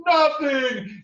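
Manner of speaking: high, loud booming "Nothing"; bear insisting. Acted. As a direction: insist with pride.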